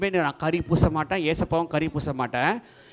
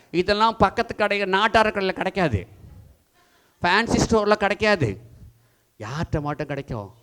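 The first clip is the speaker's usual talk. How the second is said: ordinary talk, nothing marked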